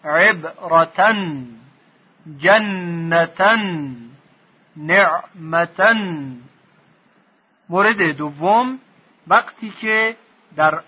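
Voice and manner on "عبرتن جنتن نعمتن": عبرتن جنتن نعمتن each drawn out very slowly; slow drilled tanwin endings; slow recitation. Shown as a demonstration. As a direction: recite each word slowly with final -tan. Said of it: "فقط دو تا نقطه".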